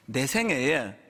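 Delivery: ordinary delivery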